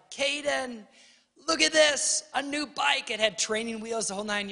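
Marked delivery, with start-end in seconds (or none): 0.1-3.1 high, bright Christmas announce; excited parents. Retold or imitated. Parents presenting the new bike to their son, excited and high. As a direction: show it off with big excitement. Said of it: "we're like".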